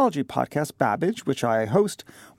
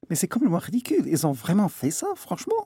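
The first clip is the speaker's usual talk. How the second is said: French chef; 2 semitones higher, faster than usual. Pretend. Mock French reaction to fake Paris.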